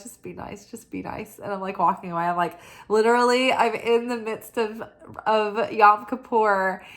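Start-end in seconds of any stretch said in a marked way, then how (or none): 0.0-1.4 self-soothing murmur. Retold or imitated. Inner voice calming herself.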